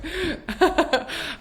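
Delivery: short high burst of laughter. Real, not acted. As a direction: laugh it off, amused. Laughs at audio feedback.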